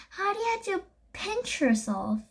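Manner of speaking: usual speaking voice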